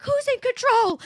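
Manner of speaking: high, loud shout. Retold or imitated. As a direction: yell the question at her. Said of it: "saying to her".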